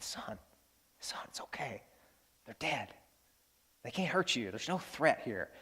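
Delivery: soft, near-whispered fatherly comfort; quietly calm a frightened child; hushed reassurance. Retold or imitated. Dad comforts his scared son among stuffed animals, hushed.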